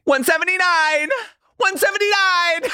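high excited shout of the number; excited squeal. Retold or imitated. Friends trading Legally Blonde lines, high excited squeal. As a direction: squeal it like Elle Woods. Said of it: "huge for line reads".

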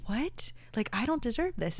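high-pitched retold disbelief; incredulous self-doubt. Photographer voices feeling undeserving, incredulous. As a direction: blurt it as a baffled inner protest.

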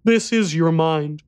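put-on high, slow child voice; solemn young boy. A narrator's reading. Boy revealing the truth, slow solemn child voice. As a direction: reveal it slowly as a grave little boy.

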